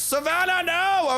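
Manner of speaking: alarmed shout. Real, not acted. Colonist dying.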